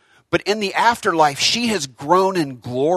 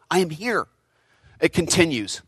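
ordinary talk

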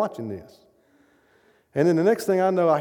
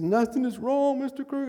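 put-on tearful young woman; 10 semitones higher than usual. Young woman reassuring the speaker, voiced high and teary. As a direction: answer him gently through tears, pitched high. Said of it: "She said".

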